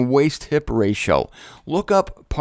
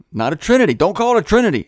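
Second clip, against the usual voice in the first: higher, emphatic mock correction; mock scolding; 5 semitones higher, 4 dB louder, much faster than usual. Speaker playfully corrects anyone calling it a Trinity, mock scolding. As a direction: jump in with a playful telling-off.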